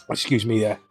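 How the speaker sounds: ordinary delivery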